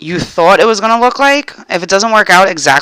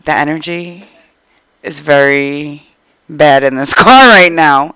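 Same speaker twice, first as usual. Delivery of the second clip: low, slow, ominous deadpan; measured mock-grave pronouncement; retold inner thought; 7 semitones lower than usual. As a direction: think it aloud slowly and low. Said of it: "She said this in her head".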